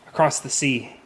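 usual speaking voice

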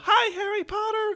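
high yelled greeting from a passing van; kids yelling. Retold or imitated. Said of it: "the kids in the back would yell".

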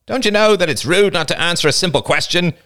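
high-pitched aggrieved insistence; pained, aggrieved, insistent. A narrator's reading. Older boy scolding silent girls, pained and insistent. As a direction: press the point as if wronged. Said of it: "His voice sounded pained, aggrieved, insistent".

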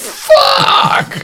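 very high, loud re-enacted scream; shocked shout. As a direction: yell it out as a player in shock. Re-enacting shock at a story moment.

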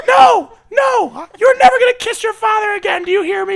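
screaming high and loud, enraged; furious yelling. Acted. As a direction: scream at him like a furious wife. Improv mom screaming.

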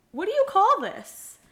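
high, loud indignant question; retold exasperation. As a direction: re-enact snapping back at the boss. Retold argument about covering shingles.

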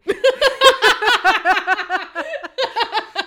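high loud burst of laughter. Real, not acted. Laughing at her own outro joke to viewers.

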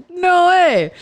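high, loud burst of disbelief; surprised disbelief. Retold or imitated. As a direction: gasp out the denial in shock. Shocked reaction to friend's news.

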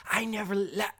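mock shrill denial cut off; mocking high yelp. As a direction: mimic her blurting a denial. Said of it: "She literally was like".

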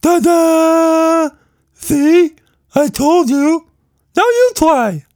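very high, loud squeaky creature, ta-da; triumphant Quatch Monster. A narrator's reading. Creature hanging from the swing, triumphant squeaky shout. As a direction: show off like a proud little acrobat.

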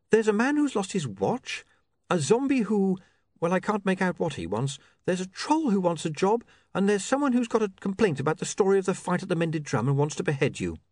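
put-on high-pitched young assistant; high, matter-of-fact female character; businesslike Saccharissa. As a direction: run down the list in a high voice. Voices Saccharissa.

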